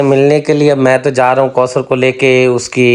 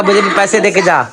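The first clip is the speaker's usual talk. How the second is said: a teasing imitation of an angry demand for money; mock angry demand; 4 semitones higher than usual. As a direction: copy her sharp ask for cash, half joking. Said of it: "असल में बात जो है ना गुस्से वाली है". (नकली गुस्से में पैसे माँगना)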